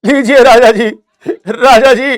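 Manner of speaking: hammed-up poor subject handing over money; pleading peasant. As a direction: beg the king with a crying whine. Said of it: "जाकर के राजा को कहेगी".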